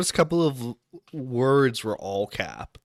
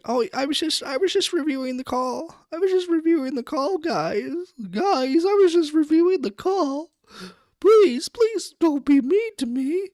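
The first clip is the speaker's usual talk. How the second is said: much higher, whimpering fake excuse; mock him as a pleading crybaby, pitched way up; whiny mock voice. Host mocks guest's excuse to chat, high whiny pleading.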